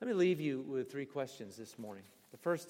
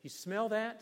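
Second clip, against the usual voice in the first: teasing Finn; 8 semitones higher than usual. Retold or imitated. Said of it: "They would say".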